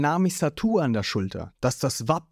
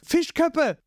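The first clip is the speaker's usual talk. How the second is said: shocked scream; 14 semitones higher than usual. A narrator's reading. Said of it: "Beide schreien vor Schock".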